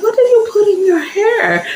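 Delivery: high, curious church-lady question; pry about her hair; nosy church lady. Retold or imitated. The wife asks what is in the teller's hair, high and curious.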